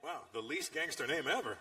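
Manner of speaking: normal talking voice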